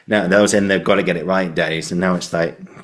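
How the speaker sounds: ordinary delivery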